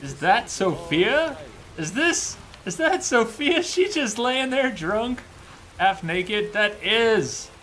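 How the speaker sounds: loud, high-pitched disbelief; amazed discovery. Real, not acted. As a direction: exclaim it in rising, incredulous surprise. Startled at finding Sophia naked.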